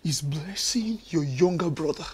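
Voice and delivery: low, soft, suspicious question; quiet probing question. Acted. Husband questioning wife, quietly suspicious. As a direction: ask it quietly, testing her.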